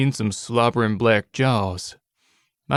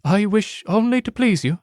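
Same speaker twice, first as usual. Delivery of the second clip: high, eager-to-please character voice; high and submissive; devoted rabbit girl; 9 semitones higher than usual. Acted. Rabbit girl pleases her lord.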